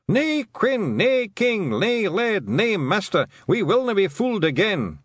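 high, loud Feegle chant in broad Scots; rowdy crowd cry. A narrator's reading. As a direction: shout it out as a defiant little mob. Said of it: "drowned out by the cry that went up".

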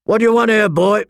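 acted guard's call, pitched well up. A narrator's reading. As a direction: challenge the stranger from across the way. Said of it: "the one walking the rounds called out".

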